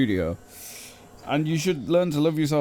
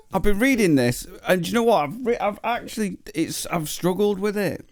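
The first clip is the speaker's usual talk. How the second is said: ordinary talk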